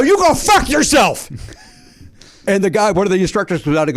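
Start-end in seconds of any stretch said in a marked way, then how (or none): 0.0-1.3 high, drawn-out angry yell, reenacted; angry retort. Golfer swearing back in a quarrel, acted angry yell. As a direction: hurl it back slowly, pitched way up.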